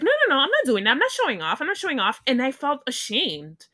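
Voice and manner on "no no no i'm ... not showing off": fast, higher, rushed protest; flustered denial. Retold or imitated. Girl denying to the mocking boy, fast flustered protest. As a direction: blurt it out defensively, fast. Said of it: "immediately I was like".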